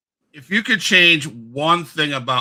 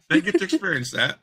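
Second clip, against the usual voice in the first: laughing; 8 semitones higher, 3 dB louder than usual. Real, not acted. Laughing while speaking.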